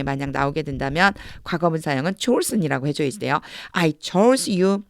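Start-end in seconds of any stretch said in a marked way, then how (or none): 3.7-4.8 I chose you drawn out very slowly, rising on chose; teacher models the line word by word for students; slow model reading. Shown as a demonstration. Teacher reading the English verse aloud to the class, very slow and rising. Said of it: "이건 chose라 이렇게 발음돼요".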